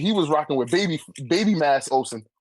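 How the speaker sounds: ordinary talk, nothing marked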